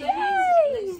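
hoog en luid gejuich; excited cheer. Real, not acted. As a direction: juich het hoog uit.

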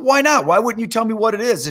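high, pressing demand to know; acted youngster objecting to a refusal; indignant protest. Boy challenges pastor who will not tell, indignant protest.

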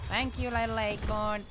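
slipping into an American accent. Real, not acted. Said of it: "Don't know why I sounded so American then".

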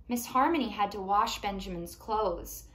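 plain reading voice

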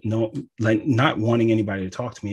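ordinary talk

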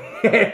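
short, high, loud laugh. Real, not acted. Reviewer after killer burn session, laughing. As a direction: burst out laughing mid-sentence.

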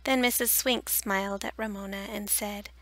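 plain reading voice

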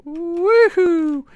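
high drawn-out woohoo; whoop. Real, not acted. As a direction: let out a high, sustained whoop. Whoops while playing the game.

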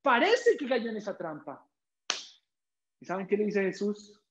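usual speaking voice

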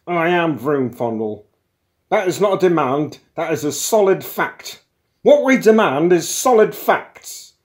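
loud, high, building tirade; bawling. A narrator's reading. Intruder banging the desk at his partner, bawling. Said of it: "bawled Broom Fondle".

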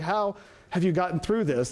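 usual speaking voice

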